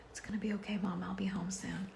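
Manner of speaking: daughter's gentle whisper; whispered reassurance. Retold or imitated. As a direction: soothe mom in a hush. Daughter comforting her, whispered.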